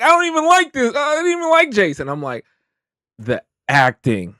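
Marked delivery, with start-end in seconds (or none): 0.0-2.1 very high hammed-up wail; mock-tearful protest. Viewer who cried over a disliked character, mock-tearful protest. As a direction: protest your own tears in a squeal.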